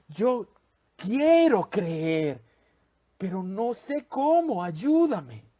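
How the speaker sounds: slow, halting plea of a desperate father; pleading father. Acted. The boy's father begging Jesus, slow and pleading. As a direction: beg for help, stretching out the words.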